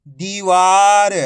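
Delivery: दीवार very slow and loud, sounded out; drawn-out long ई. Shown as a demonstration. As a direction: stretch दी and वा in दीवार. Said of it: "खींच के पढ़ा जाता है".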